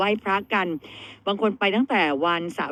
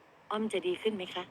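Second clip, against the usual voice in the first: slow, hushed question to an oracle; soft pleading question; 3 semitones lower, 9 dB quieter, slower than usual. Retold or imitated. Host re-enacts asking the fortune sticks after heartbreak, soft and slow. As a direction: ask it softly and slowly, as if praying. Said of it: "แล้วถามว่าอะไรรู้ไหม".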